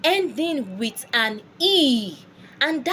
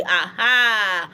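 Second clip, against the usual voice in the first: ordinary talk, nothing marked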